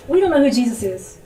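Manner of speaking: loud, imitated protest; retold forceful objection. Friend protesting how Christians approach the Japanese, forceful. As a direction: push back hard as the friend.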